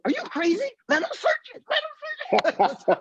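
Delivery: high, frantic urging; pleading partner, voice shooting up; panicked partner. Retold or imitated. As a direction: squeal it like a scared friend begging. Partner panics at cop search.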